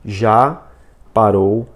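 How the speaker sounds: slow, halting read, a pause after each word. Shown as a demonstration. Teacher shows viewers the learned way of reading, word by word. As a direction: read it in separate, labored chunks. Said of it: "Eu tô lendo da forma que eu aprendi".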